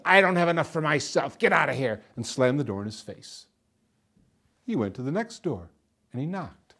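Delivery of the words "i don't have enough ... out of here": firm, dismissive brush-off; gruff refusal. A narrator's reading. Old man turning away a hungry soldier, dismissive. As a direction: send the beggar packing.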